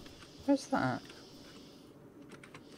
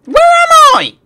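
shrieked very high and loud; angry shout; 13 dB louder than usual. Real, not acted. Gamer shrieking at the game after falling into nothingness. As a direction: yell at the game in disbelief.

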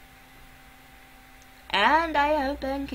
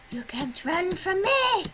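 high, loud mock threat; playful villain shout; 8 semitones higher, 6 dB louder than usual. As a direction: yell it like a cartoon chaser. Chasing a pig in game.

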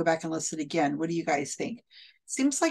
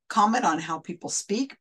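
ordinary talk

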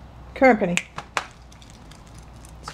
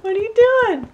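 high pet talk; 13 semitones higher than usual. Real, not acted. Asking the pet what it's up to in high baby talk. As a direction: squeak it at the puppy.

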